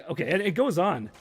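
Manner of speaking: normal talking voice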